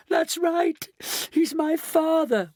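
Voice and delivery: very high distressed wail. A narrator's reading. Mrs Bucket about her baby father, wailing. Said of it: "wailed Missus Bucket".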